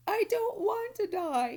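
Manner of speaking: a sobbing piglet's howl; bursting into tears. A narrator's reading. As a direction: wail it through sobs. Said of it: "Wilbur burst into tears".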